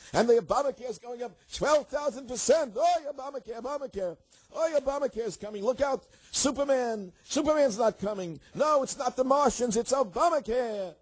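high wailing mock old lady alarm, half sung; old lady panic. Host parodies media old ladies, high wailing panic. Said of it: "complaining like old ladies".

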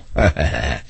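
low chuckle at his own joke; short, dry laugh to himself; brief low laugh. Real, not acted.

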